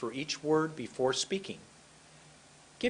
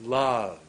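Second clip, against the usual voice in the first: LOVE long and pushed, falling; expressive intonation; 3 semitones lower, 4 dB louder than usual. Shown as a demonstration. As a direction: stretch out LOVE. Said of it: "How do you express love".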